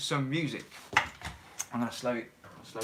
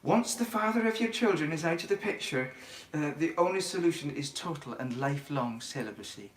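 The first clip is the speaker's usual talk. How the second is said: put-on Mrs Doubtfire's Scottish accent. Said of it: "my Scottish accent is improving then".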